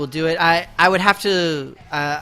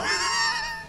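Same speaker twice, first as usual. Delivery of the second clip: squeaky laugh; 7 dB quieter than usual. Real, not acted. Laugh after answer.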